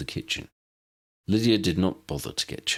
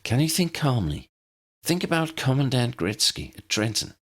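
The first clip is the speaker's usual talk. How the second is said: higher, earnest agent voice; lightly voiced anxious agent; young woman agent; 6 semitones higher than usual.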